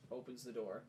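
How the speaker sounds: usual speaking voice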